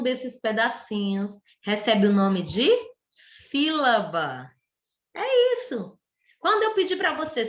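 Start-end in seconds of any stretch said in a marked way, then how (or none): none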